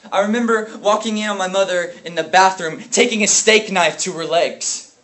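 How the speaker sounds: anguished recollection. Acted. Brother recalling his mother in the bathroom, anguished outburst. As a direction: let the memory burst out loud. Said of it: "grieving over an unbearable past".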